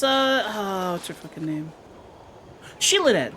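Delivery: ordinary delivery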